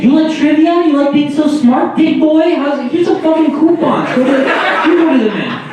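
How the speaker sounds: high, loud jeering rant; hammed-up bully jeer, pitched high; mocking taunt. Acting out taunting trivia hosts.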